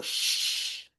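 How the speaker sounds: "X" as a long shhh; soft, sustained hush on "X"; sound of X. Shown as a demonstration. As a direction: hold a voiceless sh for "X". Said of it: "O som do X".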